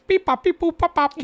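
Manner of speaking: very high, loud staccato robot beeps; pretend droid. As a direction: imitate someone earnestly faking droid bleeps. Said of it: "someone was pretending to be a droid".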